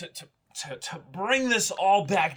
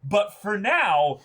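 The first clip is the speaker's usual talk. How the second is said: slow, rising much higher and louder; building excitement; 11 semitones higher, 5 dB louder, much slower than usual. Real, not acted. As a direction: stretch it out for drama. Building dramatic recap of cliffhanger.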